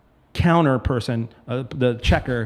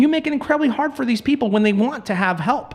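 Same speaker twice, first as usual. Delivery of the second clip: heated rant; 7 semitones higher than usual. Real, not acted. Ranting about realtors.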